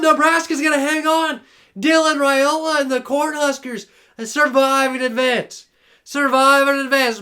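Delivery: lively sports announcer call; play-by-play. Acted. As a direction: call the action as it unfolds. Live play-by-play of game.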